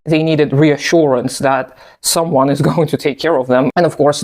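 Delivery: usual speaking voice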